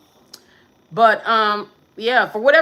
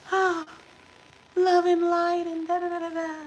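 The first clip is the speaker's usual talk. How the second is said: mock sing-song; 8 semitones higher, 5 dB quieter than usual. Mocking generic readers.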